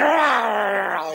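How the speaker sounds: loud, drawn-out imitated snarl; mimicked guard-dog growl. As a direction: growl long like an angry dog.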